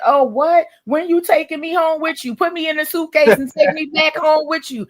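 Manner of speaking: high, loud, excited begging to go along; excited high pleading. Retold or imitated. To Liberians, excitedly begging to be taken home. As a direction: squeal it like an eager relative begging. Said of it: "I said".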